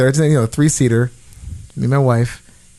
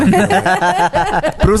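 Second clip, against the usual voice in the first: laughing; much slower than usual. Real, not acted. Laughing while telling story.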